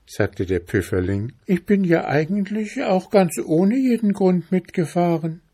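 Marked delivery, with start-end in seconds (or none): 1.5-5.4 high-pitched tiny mushroom; squeaky little voice. A narrator's reading. Little chanterelle explaining to the bear, squeaky high voice. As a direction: pipe it up in a small high voice. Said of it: "mit einem so piepsigen Stimmchen".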